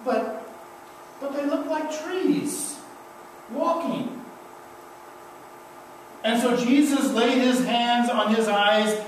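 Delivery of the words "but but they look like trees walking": lightly voiced, high and hesitant; half-healed man puzzling at shapes; a hint of a wondering blind man. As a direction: blind man describing blurry sight, a touch awed. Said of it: "He says".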